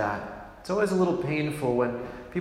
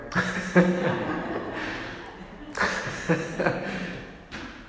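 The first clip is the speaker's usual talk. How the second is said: real laugh; 5 semitones higher than usual. Real, not acted. Priest amused by his parents, airy laugh. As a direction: let the laugh slip out under the breath.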